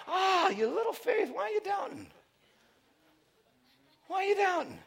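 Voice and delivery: high teasing rebuke; lightly voiced gentle Jesus. Pastor voicing Jesus gently chiding Peter, lightly voiced. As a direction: chide him gently, like a teacher.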